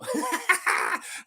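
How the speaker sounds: high burst of laughter. Real, not acted. Host amused by own accent attempt, high laugh.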